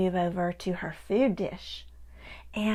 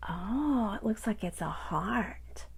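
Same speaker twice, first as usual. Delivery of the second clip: usual speaking voice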